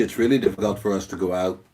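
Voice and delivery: ordinary talk, nothing marked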